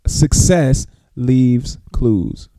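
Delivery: "Success leaves clues" very slowly, word by word; slow dictation. Real, not acted. Host repeats a motto for listeners writing it down, slow dictation of "Success leaves clues". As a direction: spell out "Success... leaves... clues" with pauses between words.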